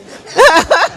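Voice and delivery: high, loud burst of laughter; delighted laugh. Real, not acted. Diner after naming her rum punch, delighted laugh.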